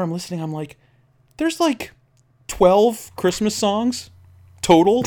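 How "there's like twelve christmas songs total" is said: high, drawn-out disbelief with pauses; incredulous. Retold or imitated. Exasperated listener realizing the holiday station keeps repeating, incredulous. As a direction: squeak it out slowly, piece by piece.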